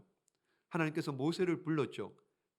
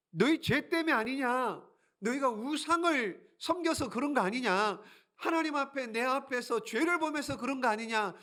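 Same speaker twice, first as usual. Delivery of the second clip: higher, stern rebuke; much higher, firm reproach; rebuking God; 8 semitones higher, 3 dB louder than usual. Acted. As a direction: scold the people for their idols.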